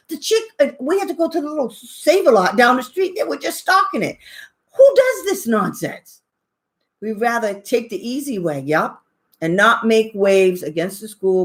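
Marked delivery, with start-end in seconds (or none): 4.8-6.2 exasperated outcry. Real, not acted. Ranting about empty stores.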